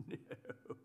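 ordinary talk

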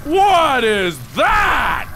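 high, stretched-out cries of bewilderment; baffled yelling. Real, not acted. Player has no idea what hit him, baffled yelling.

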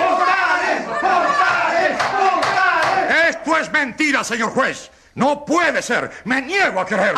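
very high, shouted protest; outraged denial. Acted. Official shouting at the journalist, outraged high denial. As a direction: refuse to believe it, outraged.

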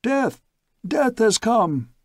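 crowd shouts. Acted. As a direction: wail it like a mob in dread. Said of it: "We could hear the shouts".

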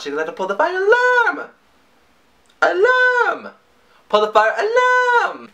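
very high, excited yells; playful belting. Pretend. Vlogger belting the song line, very high and gleeful. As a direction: belt it like a diva.

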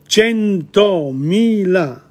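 Centomila slow, each syllable said clearly; every syllable. Shown as a demonstration. Teacher modelling careful pronunciation of Centomila for students. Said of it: "every single one of the syllables".

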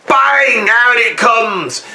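shouted, slowed climax; big dramatic cry. Acted. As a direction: burst it out like an explosion. Climax of dramatic jazz metaphor.

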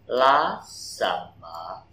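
slow whisper, stress on LAST. Shown as a demonstration. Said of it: "trọng âm câu". (thì thầm chậm, nhấn vào last)

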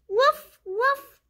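high little woof woof of a puppy; puppy bark. Acted. The puppy Biscuit barking back, high happy woofs. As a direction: yip it like a happy small dog.

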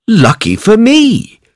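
high, delighted farmer voice; gleeful farmer. Acted. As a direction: squeal with glee at the find. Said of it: "said the farmer".

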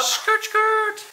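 squealing skrrt sound; high tyre screech imitation. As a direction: screech like tyres.

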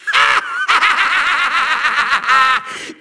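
triumphant villain laugh; evil cackle. Acted. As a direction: cackle in a rapid rolling burst. Villain foretells failure.

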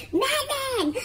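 Sammy yelling from the door for his dog to attack. Acted. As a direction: shriek the command to the dog. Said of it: "y grita".

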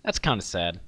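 normal talking voice

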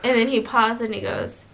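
ordinary talk, nothing marked

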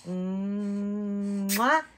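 long, drawn-out smacking kiss; blown kiss. Acted. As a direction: blow a big kiss to the viewers. Blowing everyone a kiss.

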